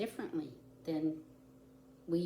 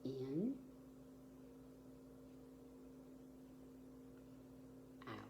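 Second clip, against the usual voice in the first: guided breathing count; 12 dB quieter than usual. Shown as a demonstration. Teacher counting children through starfish breathing, softly. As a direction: lead the children's breaths gently. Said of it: "So when you breathe in".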